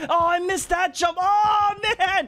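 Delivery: high, drawn-out wail of frustration; frustrated cry. Real, not acted. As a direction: cry out at a botched move. Missed a jump.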